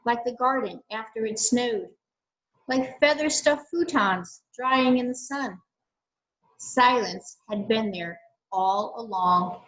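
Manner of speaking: calm, measured picture-book reading with long pauses; story narration. Acted. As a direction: read the story gently to the class, letting the silences sit. Teacher reads picture book aloud.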